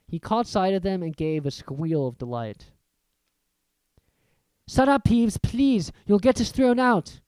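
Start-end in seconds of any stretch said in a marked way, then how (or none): 4.7-7.2 pleading schoolboy. A narrator's reading. Pleading with the poltergeist.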